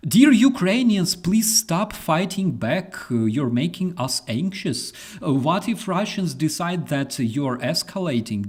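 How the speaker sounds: high, loud whiny plea; high sarcastic whining plea; mock anxious American. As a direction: parody a frightened American begging. Mocking anxious Americans addressing Ukrainians.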